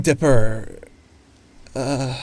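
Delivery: ordinary delivery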